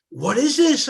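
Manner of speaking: put-on high, loud child's shocked "What is this?"; startled question. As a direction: ask it like a startled little kid. Child asks about swastika tiles.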